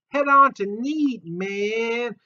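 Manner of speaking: high, slow stoned hippie drawl. Acted. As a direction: mellow laid-back hippie voice. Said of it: "Sometimes I go into hippie voice".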